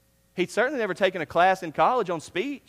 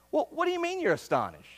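quizzical question; 10 semitones higher than usual. Acted. Questioner challenges the crowd.

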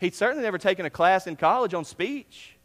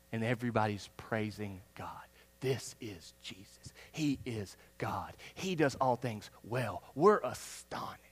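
slow, soft, lower awed murmur; hushed awe; 8 semitones lower, 8 dB quieter, slower than usual. Acted. Astonished crowd after the healing, hushed and slow. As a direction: breathe it out in quiet wonder. Townspeople praising God, voiced.

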